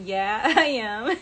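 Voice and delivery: slow words dissolving into a real laugh; sheepish real laughter. Real, not acted. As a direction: laugh through the admission. Admitting the prank, laughing.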